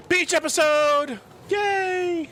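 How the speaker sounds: high, drawn-out whoop of joy; excited cheer. Real, not acted. Player cheers for beach episode.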